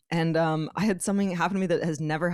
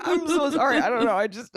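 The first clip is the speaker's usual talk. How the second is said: mock teary apology; 6 semitones higher than usual. Retelling bursting into tears, mildly teary higher apology. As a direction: apologize while fighting tears, half-played. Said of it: "I went".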